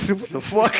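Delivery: high, laughing what-the-hell; laughing disbelief. Retold or imitated. Retelling the challenge he got, laughing in disbelief. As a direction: crack up mid-question.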